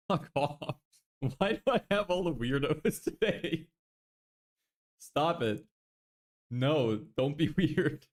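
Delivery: high, giggly scolding; laughing protest. Real, not acted. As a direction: laugh while telling chat off. Chat teasing him.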